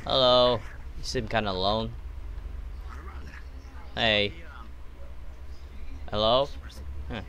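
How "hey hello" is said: usual speaking voice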